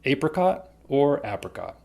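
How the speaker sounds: apricot with long a, then apricot with short a; two pronunciations. Shown as a demonstration. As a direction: say "apricot" first as AY-pricot, then AP-ricot. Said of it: "It's called".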